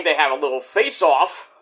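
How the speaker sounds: ordinary delivery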